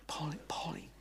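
breathy whispered name. Acted.

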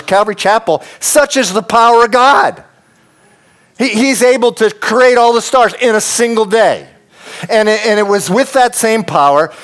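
rising fervent preaching; fiery preaching. Acted. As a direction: proclaim it to the whole church. Preaching at full force.

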